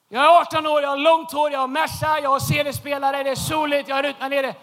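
loud, cocky rundown of his assets; loud proud list for the congregation; loud bragging. Real, not acted. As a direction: boast it loudly, one item at a time. Bragging list of teen glory.

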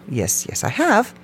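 high, bright self-answer; eager yes. Real, not acted. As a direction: chirp it like raising your hand. Answers her own question brightly.

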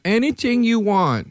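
high, slow come-on voice; mock seductive falsetto; imitating her. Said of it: "And then she went".

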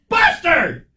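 high, slow shout through laughter; dad yells at the dog to get away from the guy; furious dad. Retold or imitated. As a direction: yell the punchline at the dog like a fed-up dad. Dad's final punchline shout.

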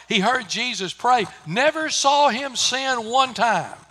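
loud, ringing pulpit delivery; full-force preaching. Acted. Preacher driving the point home to his congregation, loud and ringing. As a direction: preach it out to the whole church.

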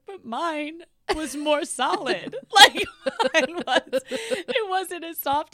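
mock choked up. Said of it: "starts getting choked up".

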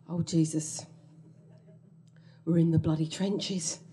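ordinary talk

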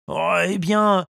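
high, whining hesitation; whiny voice. A narrator's reading. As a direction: whine to avoid school. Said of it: "d'une voix geignarde". (voix geignarde)

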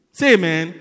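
loud call to the congregation; rally the church to answer amen. Real, not acted. Preacher calls for response.

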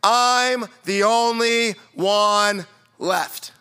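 very slow, drawn-out mope; mock self-pity. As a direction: stretch every word like a sulking prophet. Listener's self-pity mocked.

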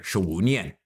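十五 nian, tones spelled out, shi then wu then nian; Mandarin demo. Shown as a demonstration. Language corner host repeating the phrase, careful demo. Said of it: "十 as in ten, wu as in five, nian, year".

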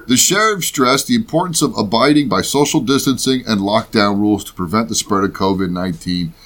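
ordinary delivery

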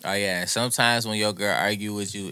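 normal talking voice

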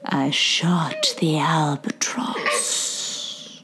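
slow, eerie whispered confession. Acted. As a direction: breathe it out like a dark secret. Climax line of poem.

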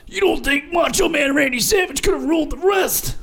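strained, high-pitched wrestler shout; Macho Man Randy Savage. Acted. Player defending his impression to host, hammed-up wrestler shout. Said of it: "as he does that impersonation".

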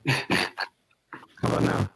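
distorted robotic mic sound; glitchy robot-like audio. Real, not acted. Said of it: "Now you sound like a robot".

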